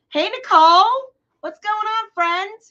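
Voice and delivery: high, loud squealed hello; excited greeting. Real, not acted. Host greeting Nicole in the chat, squealing. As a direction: greet her like a long-lost friend.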